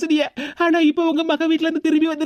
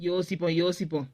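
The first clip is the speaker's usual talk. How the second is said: much lower and softer sly murmur; scheming aside; 9 semitones lower, 10 dB quieter than usual. Acted. Daughter-in-law muses to herself about spiking the coffee, sly murmur. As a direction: plot it under your breath.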